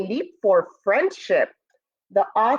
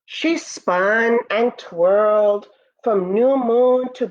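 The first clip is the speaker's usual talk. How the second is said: storybook narration; 2 semitones higher than usual. Acted. Teacher reading Moon's First Friends to students, storybook voice. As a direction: read it like a bedtime story for the class. Reads picture book aloud.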